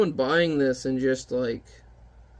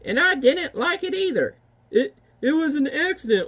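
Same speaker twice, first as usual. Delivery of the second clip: put-on high old woman's voice; old lady; 11 semitones higher than usual. Old woman of the mountain scolding the giant, high voice. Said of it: "this old lady comes out".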